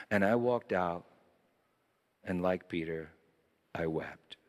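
low, soft, unhurried reading; hushed tenderness. A narrator's reading. Preacher reading the priest's discovery of love, hushed and tender. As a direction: let each phrase settle gently into a pause.

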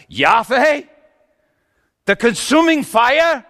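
loud, high questioning cry; incredulous outburst. Acted. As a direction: blurt it in loud disbelief. Incredulous at who fathered child.

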